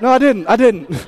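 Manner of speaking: ordinary delivery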